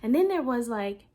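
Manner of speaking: ordinary talk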